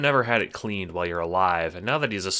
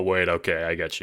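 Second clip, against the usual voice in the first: usual speaking voice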